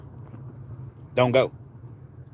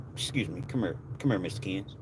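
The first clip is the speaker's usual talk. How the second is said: discreet murmur; 5 semitones lower, 10 dB quieter than usual. Retold or imitated. Suits pulling him aside quietly.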